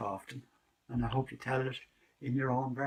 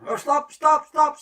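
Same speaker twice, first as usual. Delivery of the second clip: high, loud cry of stop; shouted stop; 15 dB louder, slower than usual. Acted. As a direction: cry out to halt him. Rooster stops wolf.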